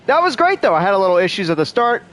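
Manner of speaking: bright, excited reaction. Real, not acted. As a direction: burst out happily. Excited reaction after finishing section.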